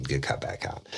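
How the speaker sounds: soft conspiratorial aside to the listener; whispered aside. Real, not acted. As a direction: drop to a whisper for the joke. Whispered aside during retold interrogation.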